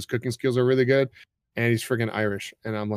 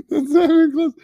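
laughing; 10 dB louder than usual. Real, not acted. Teasing friend's failed accent.

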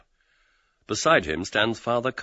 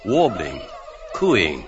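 lively narration; 6 semitones higher, 6 dB louder than usual. A narrator's reading. Kisses evoked.

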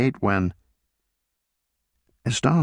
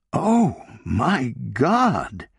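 a sudden shocked exclamation, higher; exclaimed; 5 semitones higher, much slower than usual. A narrator's reading. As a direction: gasp it out in disbelief as an older woman. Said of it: "exclaimed Sarah".

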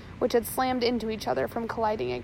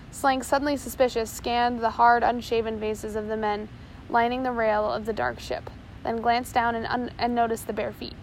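ordinary delivery, nothing marked

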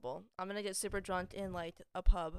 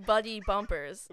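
slow careful; 5 dB louder, slower than usual. Shown as a demonstration. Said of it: "not, like, fast".

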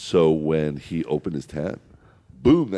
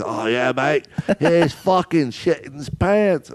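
mock Australian accent, higher and slower; 8 semitones higher than usual. Retelling the hunting mates' story in a mock Australian accent. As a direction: ham up an Australian bloke's accent. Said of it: "they're all the accents".

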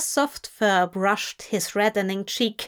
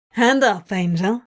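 cheeky; 4 dB louder than usual. A narrator's reading. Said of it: "Crowley asked cheekily".

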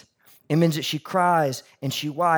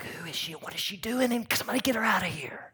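hushed, disapproving whisper behind her back; gossiping whisper; 4 semitones higher, 8 dB quieter, faster than usual. Retold or imitated. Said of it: "And everybody's like".

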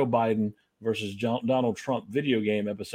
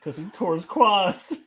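laughing through the words; 7 semitones higher, 4 dB louder than usual. Real, not acted. Laughs at own pun.

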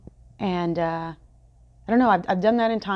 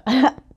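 short high giggle; embarrassed laugh; 7 semitones higher, 8 dB louder than usual. Real, not acted. Vlogger laughing at tripping over oscillate, quick giggle. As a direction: giggle at your own slip.

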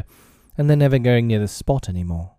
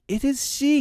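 high, excited exclamation; astonished cry. A narrator's reading. Nibs noticing Wendy has moved, high cry. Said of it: "he cried".

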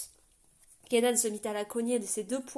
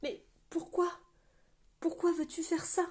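a lightly voiced, higher pleading girl; Éther's distant voice; 8 semitones higher than usual. A narrator's reading. Éther's voice begging Ruya not to die, higher and pleading. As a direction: plead gently, voice pitched up.

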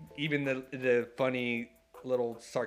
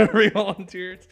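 laughing; 10 semitones higher, 11 dB louder, slower than usual. Real, not acted. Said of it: "laughing plea".

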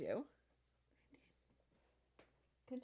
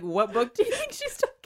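mock caption voice; 5 semitones higher, 7 dB louder than usual. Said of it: "and be like".

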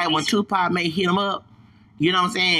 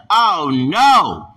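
loud, rising to a high "No"; retold loud protest; 3 semitones higher, 9 dB louder, much slower than usual. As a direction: exclaim it in loud dismay. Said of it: "I said".

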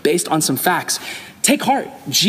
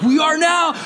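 much higher, hammed-up dizzy worry; mock alarm; 11 semitones higher than usual. Friends fooled by Jamie's chemical prank, mock alarmed. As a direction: squeak it out like you're about to faint. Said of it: "And we're like".

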